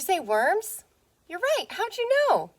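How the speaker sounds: high, bright-eyed delight at the answer; excited praise. Pretend. Teacher praising a pupil's pretend answer, excited and high. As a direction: gush over the child's guess.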